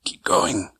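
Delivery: hoarse whispered mutter. Acted. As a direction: whisper it under your breath. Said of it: "muttered Ernie Cureo".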